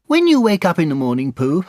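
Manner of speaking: very high, small voice; Piglet. Acted. Piglet walking home with Pooh, high-pitched. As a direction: pipe up after a silence.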